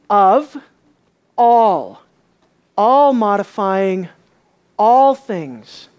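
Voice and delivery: all drawn out, loud and slow; stress on all. Shown as a demonstration. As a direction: lean hard on the word all. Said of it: "stressing the word all".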